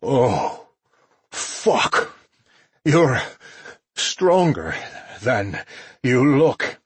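strained, effortful groan, rising on the words. A narrator's reading. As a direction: force it out between breaths, in pieces. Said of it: "and he groaned".

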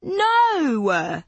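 loud, firm no; emphatic no. Acted. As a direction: shoot it down loudly. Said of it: "chorused Lauren and Clara".

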